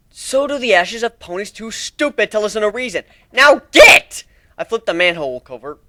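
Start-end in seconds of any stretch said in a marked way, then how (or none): 0.1-4.2 scornful scolding ending in a loud high shout; loud scornful command; angry order. Acted. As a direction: snap at them to go.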